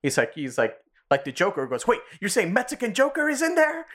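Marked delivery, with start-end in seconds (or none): 1.9-3.9 high, startled imitation; mock alarm. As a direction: act out alarmed disbelief. Retold alarmed question about the Joker.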